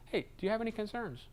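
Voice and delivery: soft concerned question to a client; gentle check-in. Shown as a demonstration. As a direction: invite them to speak, softly.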